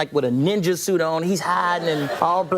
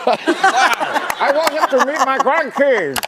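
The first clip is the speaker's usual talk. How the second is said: very slow, higher statesman impression; Nelson Mandela; 6 semitones higher, 4 dB louder, much slower than usual. African leader wanting him to meet grandkids, slow grand voice. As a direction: stretch it out with grand deliberation. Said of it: "he was like".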